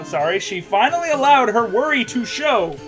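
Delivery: ordinary talk, nothing marked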